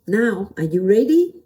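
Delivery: ordinary talk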